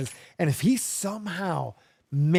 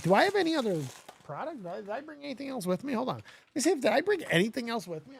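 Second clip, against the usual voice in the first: ordinary talk, nothing marked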